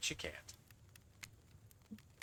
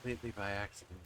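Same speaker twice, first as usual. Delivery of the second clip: low, soft, standoffish killer; aloof spirit voice; 3 semitones lower, 5 dB quieter than usual. Acted. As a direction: murmur it, pretending not to care. Spirit character voice.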